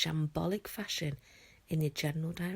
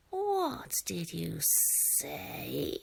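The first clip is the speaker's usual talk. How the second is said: very slow, menacing dragon hiss; much slower than usual. Acted. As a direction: draw each word out like a threat. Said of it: "hissed Fireworm".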